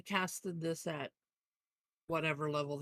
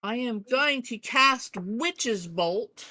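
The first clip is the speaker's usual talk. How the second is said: loud, slow, higher-pitched announcement; big deliberate proclamation; emphatic spell declaration; 5 semitones higher, 9 dB louder, slower than usual. Pretend. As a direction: declare the spell grandly, word by word. Player declares her spell emphatically.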